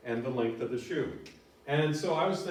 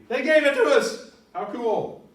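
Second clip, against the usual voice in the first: high, loud delighted exclamation; excited exclaim; 12 semitones higher, 11 dB louder than usual. Shown as a demonstration. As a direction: marvel at the gift. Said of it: "Can't scream it enough".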